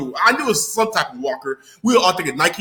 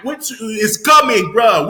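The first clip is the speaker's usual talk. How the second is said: usual speaking voice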